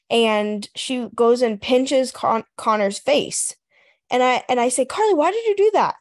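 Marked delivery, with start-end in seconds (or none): 4.9-6.0 high, quick reproachful question; dismayed parent. Retold or imitated. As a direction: act out a mother's exasperated telling-off. Mother scolding daughter, retold.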